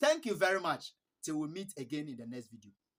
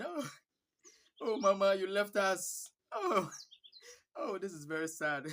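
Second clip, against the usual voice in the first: gentle mock cry; 3 semitones higher than usual. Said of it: "our cries are always gentle".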